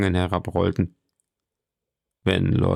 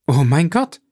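higher, fervent cry; exalted exclamation; 5 semitones higher, 4 dB louder than usual. A narrator's reading. Julie folds her hands at the count's words, exalted. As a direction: exclaim it with lofty feeling. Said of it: "rief Julie mit einem erhabenen Ausdruck".

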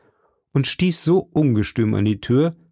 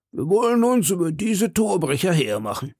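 high, hollow cellar voice; distant-sounding, higher-pitched resolve; man behind door; 7 semitones higher than usual. A narrator's reading. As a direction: speak as if through a thick door, pitch raised. Athos answering from the cellar.

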